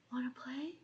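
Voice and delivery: soft eerie whisper; a quiet eerie whisper; creepy whisper. Retold or imitated. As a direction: breathe it like a hidden stranger. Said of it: "just someone saying".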